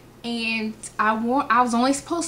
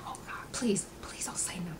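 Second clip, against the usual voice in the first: hushed, desperate mock whisper; whispered pleading; 3 semitones lower, 13 dB quieter than usual. Retelling plea to friend in class, urgent whisper. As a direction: beg under your breath. Begging friend to keep quiet.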